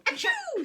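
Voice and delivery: high sneeze; queen's sneeze. A narrator's reading. Queen sneezing from the feathers. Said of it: "sneezed the queen".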